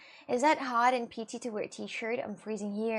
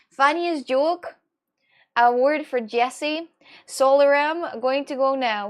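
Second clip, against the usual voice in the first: slow, loud, stretched-out proclamation; mock award announcer; 3 semitones higher, 9 dB louder, slower than usual. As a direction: announce the winner with mock grandeur. Mock award ceremony for a joke.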